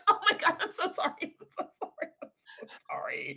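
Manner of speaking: high apology dissolving into breathless laughter; laughing mortified apology. Retold or imitated. Wife apologizing to husband, helplessly laughing. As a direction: squeak out sorries, fading to a whisper.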